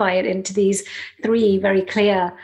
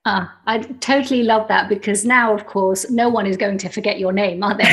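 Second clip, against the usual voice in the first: ordinary talk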